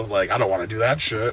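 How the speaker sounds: ordinary talk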